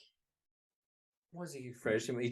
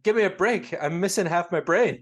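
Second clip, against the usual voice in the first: quoting Homer; 6 semitones higher, 7 dB louder than usual. Retold or imitated. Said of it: "he's like".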